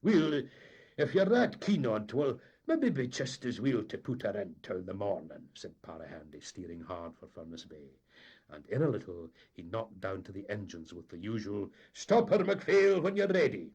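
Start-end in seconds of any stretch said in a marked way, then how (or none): 0.0-5.5 raised then easing captain; giving in. A narrator's reading. Captain conceding to the mate, easing off. 11.9-13.7 loud, higher call below; called order. A narrator's reading. Captain calling the engineer, loud.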